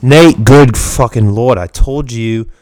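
very loud, slow exasperated scolding; blowing out the audio. Real, not acted. Host scolding co-host to speak up, very loud. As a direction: boom it right into the microphone. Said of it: "I'm blowing out the audio".

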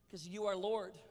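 normal talking voice

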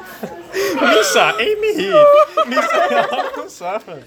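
high laughing Gungan voice; squeaky alien voice through giggles; Jar Jar voice. Pretend. Repeating Jar Jar bit.

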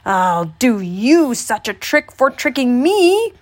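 put-on slow, loud threatening old man; old man's threat. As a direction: threaten him slowly.

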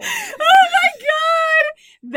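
laughing shout. Real, not acted. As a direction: cry out in hysterics. Cries out laughing.

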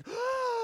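high, loud mock gasp; big acted gasp of shock. Storyteller reliving the first shocking sight, shocked gasp.

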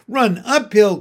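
high, loud shouted advice; excited sheep calling out; urgent cry. A narrator's reading. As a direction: cry it out across the field. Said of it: "cried the sheep".

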